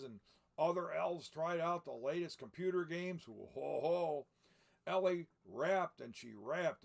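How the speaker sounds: lively read-aloud storybook voice; story narration. A narrator's reading. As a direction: read it like a picture book to children. Reads the book aloud.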